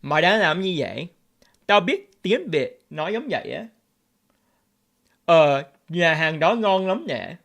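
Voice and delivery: native Vietnamese accent, conversational. Shown as a demonstration. Vlogger showing viewers how they sound in their own language, Vietnamese accent. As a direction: switch fully into your Vietnamese speaking voice. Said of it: "When I speak Vietnamese, I talk like this".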